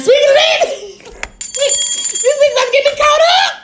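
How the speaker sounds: high, laughing, shouting. Real, not acted. Caught out by co-host, high laughing squeal.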